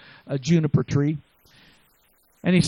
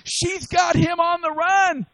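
high, slow shouted preaching; fiery preacher's cry; 12 semitones higher, slower than usual. Acted. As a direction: call it out to the congregation.

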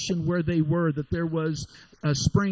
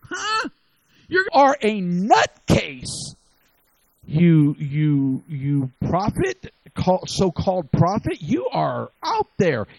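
incredulous; 5 dB louder, slower than usual. Retold or imitated. Servants scoff at Elijah.

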